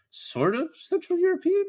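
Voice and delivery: mock-baffled question, voice climbing very high; unsure guess. Cop with no clue naming the language, unsure. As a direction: imitate a clueless guess with a soaring uptick.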